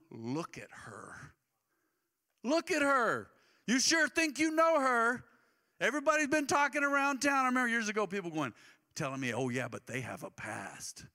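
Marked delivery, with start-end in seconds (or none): 0.1-1.3 soft slow aside. Acted. Jesus voiced speaking to Simon. 2.4-7.4 preacher voicing Jesus rebuking Simon; challenging. 9.3-11.0 dismissive gossip. Retold or imitated. Mocking gossip quoted in sermon.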